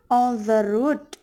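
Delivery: slow "on the road", landing on a rising "road"; stress on last word. Shown as a demonstration. Teacher giving anapestic example, slow with stress on "road". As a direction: stretch it out and lean on "road". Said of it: "the stressed one is road".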